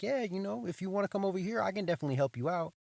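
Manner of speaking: chipper teller greeting. Retold or imitated. Bank teller greets a walk-in customer, chirpy and high.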